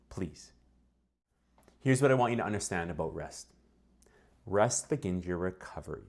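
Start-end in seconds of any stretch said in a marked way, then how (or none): 0.1-0.5 soft, exasperated whispered please; whispered scoff. Real, not acted. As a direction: breathe it out like an eye-roll. Dismissing outdated rest advice.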